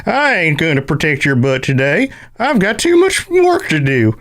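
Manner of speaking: put-on high, slow cranky old prospector. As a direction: grumble like an old gold miner. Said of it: "I want the cranky prospector".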